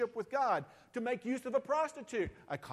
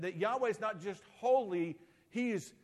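ordinary talk

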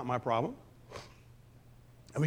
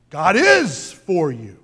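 is leaned on, slowed down, said louder; slow and louder, hit the word is; God IS for you; stress on is; 10 dB louder, much slower than usual. Shown as a demonstration. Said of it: "he emphasizes each word".

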